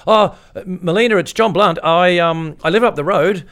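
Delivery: high, loud call through the door. Retold or imitated. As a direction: call out to be heard inside. Calling out at the door.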